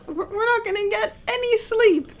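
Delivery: high mock-despairing voice; a hammed-up moan of defeat; exasperated. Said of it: "that we were like".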